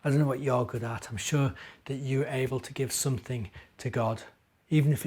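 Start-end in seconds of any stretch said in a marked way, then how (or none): none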